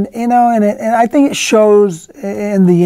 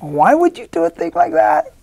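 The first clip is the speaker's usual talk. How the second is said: incredulous; 11 semitones higher than usual. Retold or imitated. Actor protesting his character's death, high incredulous. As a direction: pitch jumps high in disbelief. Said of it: "It's like".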